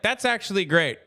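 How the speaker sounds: ordinary talk, nothing marked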